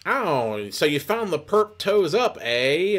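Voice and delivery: high, knowing mock-detective child; little girl turned sleuth. Little girl talking about the perp, precociously knowing. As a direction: pipe up high like a worldly little kid. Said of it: "next sentence she'll be like".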